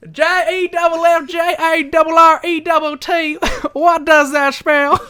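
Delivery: loud, high, committed wrestler spelling chant; Jeff Jarrett. Acted. Co-host mimics the wrestler's promo, loud high committed voice. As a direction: belt it out like a showboating wrestler. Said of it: "an impression of Jeff Jarrett".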